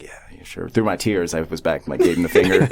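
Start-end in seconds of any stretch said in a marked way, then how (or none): 0.0-0.7 barely voiced, tearful brush-off; tearful mutter. Through tears, brushing off the roommate, a soft tearful whisper. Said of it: "and I was like".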